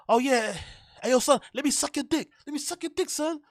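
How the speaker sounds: high-pitched New York fan impression; mock New York accent. Woman propositioning a rapper, imitated in New York accent. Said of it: "in that New York accent".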